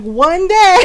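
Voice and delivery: high, loud retold threat; mock threatening shout. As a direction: raise it into a hammed-up teacher's warning. Glaring teacher's threat, escalated.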